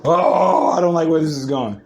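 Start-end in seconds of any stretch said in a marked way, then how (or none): none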